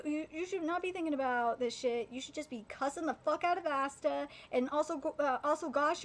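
ordinary delivery

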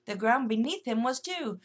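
plain reading voice

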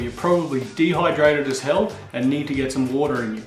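ordinary delivery

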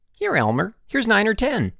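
high, eager report; excited calling. A narrator's reading. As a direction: call over with a find. Boys call out ant finds.

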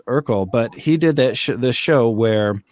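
normal talking voice

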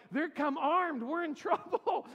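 high and loud, breaking into a laugh; half panic, half giggle at the danger; alarmed, laughing. Retold or imitated. Teen in the dark woods realizes the girls are armed, laughing alarm. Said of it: "I'm like".

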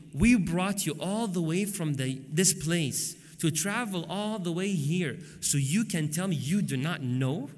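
slow, drawn-out mock complaint; indignant disbelief. Hosts rebuking their invited speaker, slow incredulous indignation. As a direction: stretch it out in disbelief. Organisers indignant at his answer.

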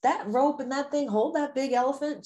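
put-on high, questioning child voice; small child's wondering question, pitched well up; little girl. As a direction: play a little girl puzzling something out for mom. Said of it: "the little girl says".

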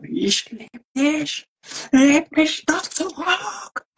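mock loving German. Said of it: "German is such a lovely language for love".

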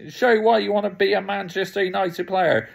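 ordinary talk, nothing marked

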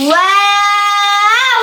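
ওয়াও held long and steady, recited; long drawn waw. Shown as a demonstration. Tajweed teacher showing pupils the long ওয়াও. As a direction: draw ওয়াও out slowly for three beats. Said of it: "তিন আলিফ টেনে পড়তে হবে".

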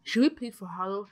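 usual speaking voice

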